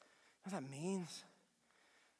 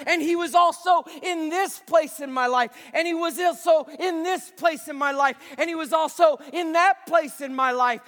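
fervent preacher building to shouts; preaching; 10 semitones higher, 15 dB louder than usual. Acted. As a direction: preach it with full conviction. Preaching to congregation.

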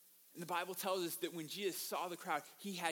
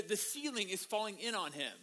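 ordinary talk

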